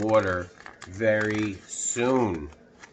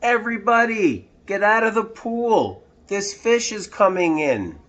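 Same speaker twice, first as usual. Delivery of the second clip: much higher, louder commanding yell; fireman yelling; 10 semitones higher, 4 dB louder than usual. Acted. Fireman yelling at swimmers, loud and high. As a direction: clear the pool with a shout. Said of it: "The fireman yelled".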